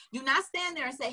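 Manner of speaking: ordinary talk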